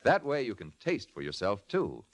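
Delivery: ordinary delivery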